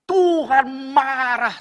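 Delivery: very loud, high, long-drawn cry; booming, slow pulpit proclamation; thundering preaching. Real, not acted. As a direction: stretch it out at full voice. Preacher thunders about God's anger.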